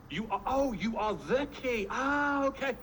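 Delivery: acted penny-dropping moment; dawning realisation. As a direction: stretch it slowly, then lift into a high "ah". Acts sudden understanding of the phrase.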